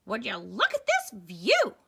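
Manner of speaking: loud, wide-eyed marvel; amazed character voice. Acted. Eddie marveling at the island, loud and amazed. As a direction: show him off the view.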